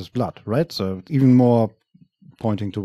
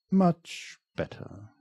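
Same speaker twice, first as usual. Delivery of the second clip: slow, high menacing purr; drawn-out predatory satisfaction; creepy villain voice; 7 semitones higher, 7 dB quieter, much slower than usual. Acted. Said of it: "the intruder whispers".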